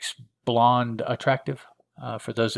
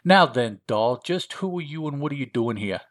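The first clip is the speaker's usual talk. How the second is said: put-on higher comic gangster accent; Ankhl's funny accent; 3 semitones higher than usual. Creature questions his captive, funny gangster accent. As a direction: interrogate like a hokey hoodlum, accent and all. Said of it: "sorry about the accent".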